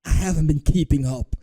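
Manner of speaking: mock quote, punched out loud; mocking his excuse. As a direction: throw the excuse back loudly. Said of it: "that's his excuse".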